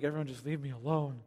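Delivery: mock weary mumble; passive dad. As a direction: sigh it like a worn-out dad who wants quiet. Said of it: "You've been passive dad".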